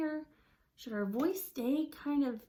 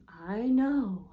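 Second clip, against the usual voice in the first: ordinary talk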